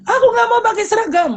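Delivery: put-on a kid refusing the uniform; stubborn child. (anak keras kepala menolak dengan suara tinggi)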